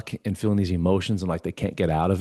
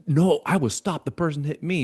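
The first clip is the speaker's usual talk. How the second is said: defensive protest; 9 semitones higher than usual. Retold or imitated. Arguing with mentor over being rear-ended, defensive. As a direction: plead your innocence in a raised, stretched voice.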